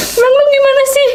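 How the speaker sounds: high-pitched indignant mock scolding; scolding God. Wife scolding God like a friend, high and indignant. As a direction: confront him like an exasperated best friend. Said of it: "Sampai lu gua ke Tuhan".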